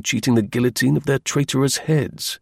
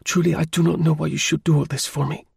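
higher-pitched, polite lady's voice; woman's voice; 5 semitones higher than usual. A narrator's reading. Countess to the man helping her, higher woman's voice. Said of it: "came at one time from a woman's voice".

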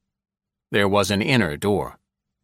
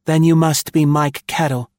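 higher, loud retort in a woman's voice; sharp retort; 8 semitones higher, 5 dB louder than usual. A narrator's reading. Secretary retorts to Mike Kettle, sharp. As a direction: fire it back at him. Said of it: "she retorted".

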